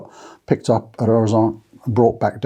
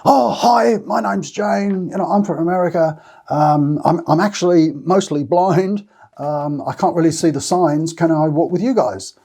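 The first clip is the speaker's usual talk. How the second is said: higher, bright pleading American pilgrim; Jane's voice; 7 semitones higher than usual. Retold or imitated. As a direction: imitate a cheerful stranger asking to join. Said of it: "it was something like".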